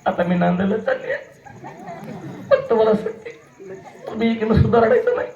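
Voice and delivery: high, laughing mock bride voice; teasing bride. Bride leaving for her in-laws teases the aunties, high and laughing. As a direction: tease the crowd as the departing bride, giggling.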